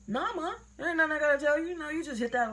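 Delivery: high, rushed mock protest; defensive denial. Child denying everything to mom, acted-out defensive rush.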